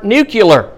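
nuclear pronounced NOO-kyuh-ler; nuclear mispronounced as nucular. Retold or imitated. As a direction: say nuclear with a kyu in the middle. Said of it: "heard him say the word".

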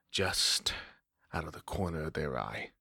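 low, soft threatening hush; vampire warning in a hush; menacing whisper. Acted. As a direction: let the threat sink to a whisper.

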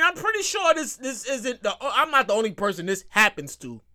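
ordinary talk, nothing marked

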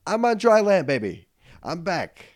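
high, loud victory cry; retold whoop of triumph; triumphant. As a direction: crow it like a winner.

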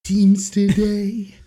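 slow, drawn-out mock cartoon singing. Singing My Way as a cartoon, slow put-on character voice. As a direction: croon it slowly in a put-on character voice.

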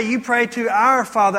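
ordinary delivery

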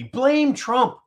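high, loud mock slogan; mocking Democrats. Host parrots the Democrats' playbook, high mocking cry. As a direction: squawk it like a parroted party line. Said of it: "What is their playbook?".

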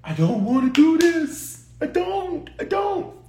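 high, drawn-out pleading whine; pleading humanity. Retold or imitated. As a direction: beg in a high, strained voice like someone who can't face it. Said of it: "Jesus' humanity said".

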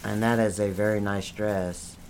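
very low, soft voice; hushed gift-giving. Acted. Presenting a present to Johnny, hushed and low. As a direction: speak low and softly, unhurried. Soft compliment in same bit.

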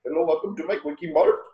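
imperfect Indian shopkeeper imitation; attempted Indian accent. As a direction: try earnestly at a comic Indian accent. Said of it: "I'm actually trying. I can't do it".

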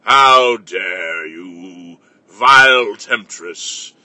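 put-on low, slow outraged old patriarch; Grandfather Cliff voice. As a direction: thunder slowly like an offended grandfather. Grandfather character answers back.

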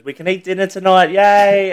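high, loud mock-excited mum; playful, high-pitched retold cheer; joking cheer. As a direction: imitate a mother jokingly cheering, rising to a loud "yay". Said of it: "And, like, just joking".

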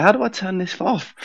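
high mock panic; panicked. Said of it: "I was panicking".

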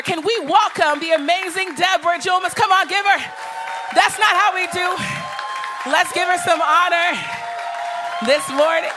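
loud, rousing call to the crowd; shouted hype. Real, not acted. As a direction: whip the room into applause. Calling the crowd to applaud.

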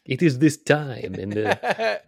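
put-on Russian accent. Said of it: "poor Russian accent".

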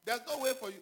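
high, loud scoffing voice of doubters; mocking doubters. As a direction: sneer it high like the naysayers.

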